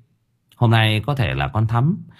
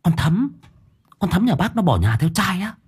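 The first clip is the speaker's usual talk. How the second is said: shocked disbelief; 8 semitones higher than usual. A narrator's reading. Teacher shocked at the news.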